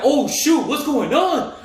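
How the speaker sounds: high-pitched mock alarm; retold panic. Retelling panic under gunfire.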